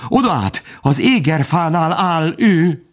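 high, frightened report; urgent, terrified pitch; panicked stammer. A narrator's reading.

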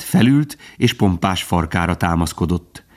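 usual speaking voice

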